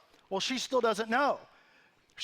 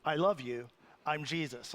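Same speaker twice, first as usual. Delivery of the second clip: lower, calm, saintly little brother; serene Jesus voice; 6 semitones lower, 5 dB quieter than usual. Retold or imitated. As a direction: play a gentle, pious Jesus. Brother playing Jesus, quoted.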